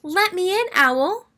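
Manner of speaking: high call at the door. Acted. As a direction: call to be let in. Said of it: "called Mouse".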